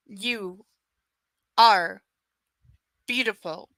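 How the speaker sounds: "are" punched loud between slow pauses; emphatic. A narrator's reading. Man insisting to his lover, "are" hit hard. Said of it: "his tone emphatic".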